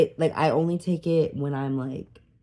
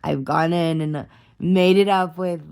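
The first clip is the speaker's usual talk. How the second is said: ordinary talk, nothing marked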